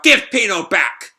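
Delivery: loud, high, drawn-out demand; yelled demand. A narrator's reading. Shiki demanding Pino's return, yelled out. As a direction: yell it across the room. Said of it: "Shiki yells out".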